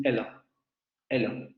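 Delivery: hello said without its h, "elo"; London dropped h. Shown as a demonstration. Teacher repeating London hello for students. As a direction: drop the first sound: "elo". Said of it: "dicen hello".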